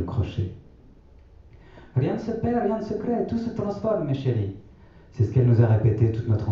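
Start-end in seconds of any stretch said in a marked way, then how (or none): 2.0-4.6 grand-mère, voix bien plus aiguë et douce. A narrator's reading. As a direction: prendre la voix haute de la vieille grand-mère. (grand-mère qui répète sa maxime aux enfants, voix aiguë)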